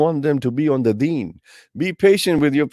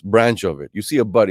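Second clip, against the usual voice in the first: ordinary talk, nothing marked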